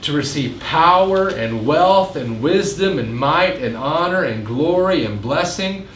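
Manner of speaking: slow, loud proclamation of praise. A narrator's reading. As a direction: proclaim it slowly and loudly, like a crowd of angels. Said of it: "saying with a loud voice".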